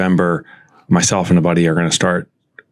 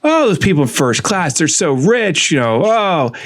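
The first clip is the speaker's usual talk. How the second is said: put-on bitching and moaning, pitched up; mock moaning; 8 semitones higher than usual. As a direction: moan it like an exaggerated envious complainer. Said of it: "I would be bitching and moaning to myself".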